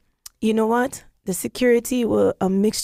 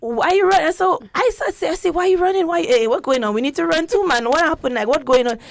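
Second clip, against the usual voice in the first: high, eager mock-alarm; jovial panic; 8 semitones higher than usual. Retelling her own jovial panic at the station, pitched high and eager. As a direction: ask it like a cheerful panicked bystander. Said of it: "it was like a jovial panic".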